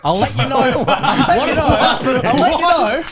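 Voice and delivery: put-on laughing through an Afrikaans accent; hammy Afrikaans accent, giggling. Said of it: "you did the Afrikaans accent".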